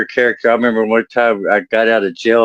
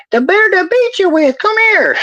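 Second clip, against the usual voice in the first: very high, loud cartoon granny; hammed-up mean granny threat; mean old grandma; 3 dB louder than usual. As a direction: screech it like an angry old lady. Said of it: "she used to be a mean old lady".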